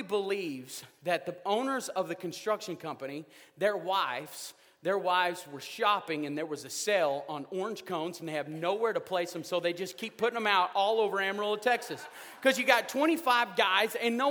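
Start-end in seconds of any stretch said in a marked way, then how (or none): none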